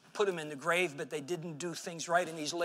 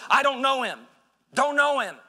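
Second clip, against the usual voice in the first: loud, high, panicked denial; hasty denial; 6 semitones higher, 8 dB louder than usual. Acted. Peter denies Jesus to the maid, loud and panicked. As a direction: brush her off fast and loud.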